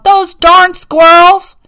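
put-on loud, high, slow cranky old man shout; angry yell. A narrator's reading. Old man yelling at the squirrels, loud and high. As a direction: shake a fist and bellow it. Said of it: "shook his old man fist and yelled".

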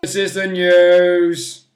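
loud, slow, drawn-out segment announcement; hammed-up booming intro; mock news announcer. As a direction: stretch the last word out like a jingle.

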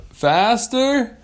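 high, loud questioning call; mishearing. Acted. Whale mishearing the rider's plea, loud questioning. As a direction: shout back a misheard word.

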